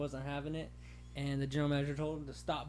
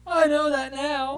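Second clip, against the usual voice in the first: slow, much higher and louder whine; mock meek employee; 12 semitones higher, 15 dB louder, much slower than usual. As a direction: whimper it back at the boss, hammed-up. Playing Ben answering the boss.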